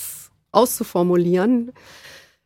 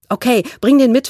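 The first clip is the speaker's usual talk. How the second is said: demanding; 4 semitones higher, 5 dB louder than usual. A narrator's reading. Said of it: "forderte Hannah sie auf".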